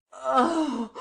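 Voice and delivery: a sudden yelp as the blade cuts; cry of pain. Acted. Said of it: "with a moan of pain".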